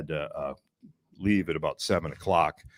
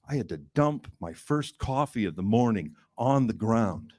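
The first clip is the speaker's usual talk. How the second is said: ordinary delivery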